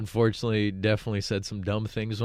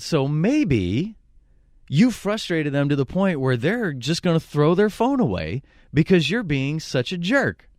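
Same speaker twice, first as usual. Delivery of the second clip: raised, loud mock-cop scolding; Officer Conway; 7 semitones higher, 5 dB louder, slower than usual. Fake officer scolds the harassing caller, loud and pointed. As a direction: deliver an emphatic official telling-off, in imitation. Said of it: "I used Officer Conway".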